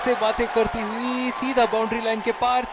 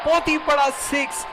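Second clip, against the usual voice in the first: hindi cricket commentary; 6 semitones higher than usual. Acted. Big six called in commentary.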